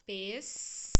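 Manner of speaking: "Pes-ce-go" dito sílaba por sílaba, com força; syllables split. Shown as a demonstration. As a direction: separe pêssego em sílabas. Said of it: "Tem um acento circunflexo". (pêssego pronunciado sílaba a sílaba)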